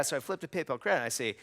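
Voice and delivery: ordinary delivery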